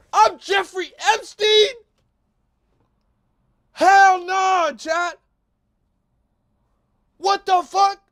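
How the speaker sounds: high, loud near-screaming outrage; crying with rage. Real, not acted. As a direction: shriek in disbelieving fury. Escalating reaction to memes.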